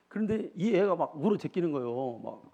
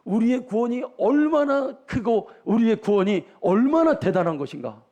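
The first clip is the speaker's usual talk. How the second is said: full-force preaching; 8 semitones higher, 9 dB louder than usual. Acted. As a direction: stretch each phrase out to the pews.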